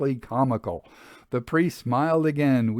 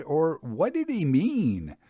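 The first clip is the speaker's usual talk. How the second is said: plain reading voice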